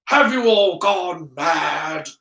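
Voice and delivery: slow, outraged teacher's bark; angry outburst, falling away at the end. A narrator's reading. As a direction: bark it in disbelief, stretching the words. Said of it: "barked Severus's voice".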